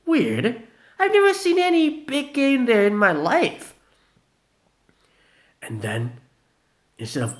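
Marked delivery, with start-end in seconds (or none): 0.0-3.7 high loud doubtful voice; puzzled drunk. Acted. Vanilla doubting the meadow, high puzzled voice. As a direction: wonder aloud, raised.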